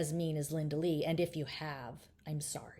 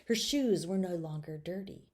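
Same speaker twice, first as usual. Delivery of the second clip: usual speaking voice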